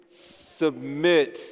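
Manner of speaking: low, drawn-out "submit", falling at the end; subdued, falling "submit"; without vibrancy. Shown as a demonstration. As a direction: say "submit" reluctantly, dropping away. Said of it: "I didn't hear the vibrancy there".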